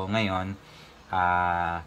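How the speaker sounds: normal talking voice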